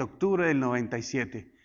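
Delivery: ordinary talk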